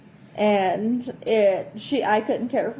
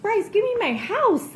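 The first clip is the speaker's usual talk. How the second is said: pleading; 9 semitones higher than usual. Retold or imitated. Pleading voice imitated.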